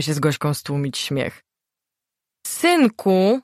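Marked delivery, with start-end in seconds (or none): 2.5-3.4 higher, louder call to the son; insistent mother's call. A narrator's reading. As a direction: demand he open up. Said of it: "Ton głosu był coraz bardziej natarczywy". (natarczywe wołanie matki)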